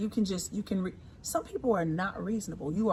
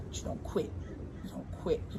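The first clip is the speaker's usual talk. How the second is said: whispered; 9 dB quieter than usual. Real, not acted. Soft repeated affirmation.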